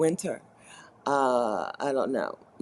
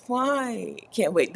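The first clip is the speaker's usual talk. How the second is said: usual speaking voice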